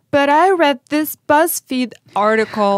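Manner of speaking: high, loud, whiny complaining woman; whiny female voice. Retold or imitated. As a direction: whine it like a know-it-all. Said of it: "classic female, I don't know, like, whiny voice".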